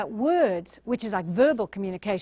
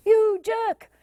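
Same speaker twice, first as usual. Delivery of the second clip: mock insult; 10 semitones higher, 4 dB louder than usual. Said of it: "if you do things like".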